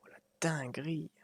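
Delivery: breathless whisper of amazement; whispered awe. Real, not acted. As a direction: whisper it in wonder. Quiet awe at view.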